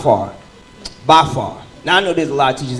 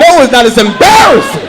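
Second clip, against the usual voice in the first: mock exasperation; 9 semitones higher, 8 dB louder than usual. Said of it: "I was like".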